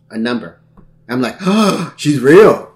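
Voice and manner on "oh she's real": louder, raised gushing reaction; excited mock amazement. Man retells discovering the online match is real, excited. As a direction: gasp out astonished delight, hammed up. Acting out stunned excitement at reply.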